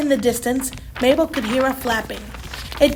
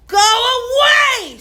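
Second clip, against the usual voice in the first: loud, high shout of refusal; 10 semitones higher, 4 dB louder than usual. A narrator's reading. Mabel shouting at the flying books, loud and high. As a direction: yell it at the swarm of books. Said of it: "shouted Mabel".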